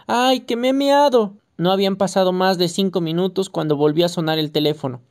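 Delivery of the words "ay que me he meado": put-on slow, higher, mildly tearful child's whine; wailing little boy. A narrator's reading. Small boy crying to his mother, slow and lightly tearful. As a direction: draw out a toddler's complaint. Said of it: "seguía llorando el pequeño".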